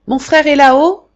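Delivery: loud, high urgent call across the courtyard; shouting to the porter. Acted. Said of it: "cria-t-il au portier".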